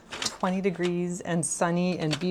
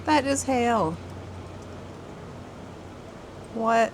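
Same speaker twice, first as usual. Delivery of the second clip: surprised; 5 semitones higher than usual. Real, not acted. Spotting hail through the window at Easter, genuinely surprised. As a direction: react as the hail starts falling.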